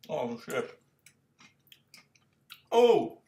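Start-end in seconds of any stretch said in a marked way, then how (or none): 2.7-3.2 sudden loud high "Oh!"; a shocked yelp at the sourness; startled cry. Real, not acted. Reacting to sour gas.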